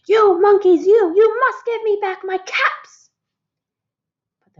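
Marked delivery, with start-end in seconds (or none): 0.1-3.1 much higher, louder furious shout. A narrator's reading. Furious peddler shouting at the teasing monkeys, high and loud. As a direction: yell it up into the tree. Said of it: "He stamped both of his feet and shouted".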